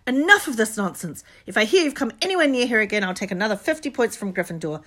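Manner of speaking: loud furious scolding; storming. A narrator's reading. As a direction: blast them, furious teacher. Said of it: "she stormed".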